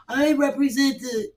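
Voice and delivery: slow, lightly put-on Karen voice; a hint of an indignant Karen; lightly voiced meddling Karen; mock Karen. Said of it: "a Karen gonna jump up and say".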